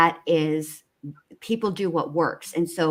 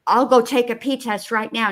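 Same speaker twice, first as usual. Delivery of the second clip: raised-pitch mock protest; mimicked dramatic outburst; 6 semitones higher, 4 dB louder than usual. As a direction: imitate someone getting worked up. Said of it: "when she really gets into it".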